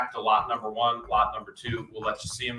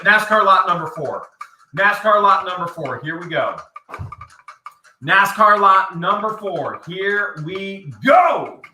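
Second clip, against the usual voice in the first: auctioneer call; 6 semitones higher, 5 dB louder, slower than usual. Acted. Auctioneer opening the lot.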